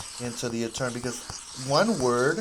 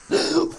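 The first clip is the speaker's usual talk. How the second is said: laugh; 6 dB louder than usual. Real, not acted. Laughing to himself while painting.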